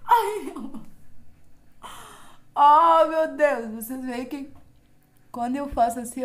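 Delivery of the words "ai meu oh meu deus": louder, higher cry of pain; pained outcry. Real, not acted. To her viewers while peeling the mask, pained outcry. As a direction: blurt it as the mask tugs.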